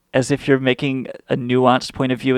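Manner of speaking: usual speaking voice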